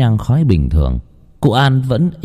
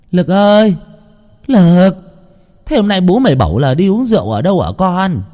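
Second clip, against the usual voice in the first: calling out; 10 semitones higher than usual. A narrator's reading. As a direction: call to her son in the back room. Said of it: "Bà đi gọi với vào trong buồng". (gọi vọng vào buồng)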